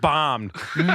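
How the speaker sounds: usual speaking voice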